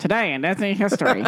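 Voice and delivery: a hint of an old radio announcer; old-timey voice. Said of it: "his, uh, old-timey voice".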